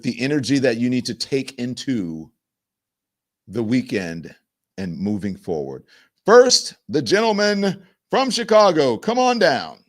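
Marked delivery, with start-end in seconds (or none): 6.3-9.8 loud, high game-show announcer call; game show host. Pretend. Host calling the Chicago man up like a game show contestant, booming.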